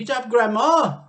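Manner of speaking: high, loud, gleeful urging; grinning Mister Cranky. A narrator's reading. Mister Cranky gleefully urges Grandma to drink. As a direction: egg her on with a big grin. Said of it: "grinning hugely".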